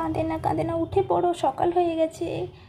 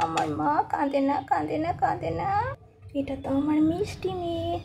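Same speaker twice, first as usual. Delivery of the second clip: ordinary talk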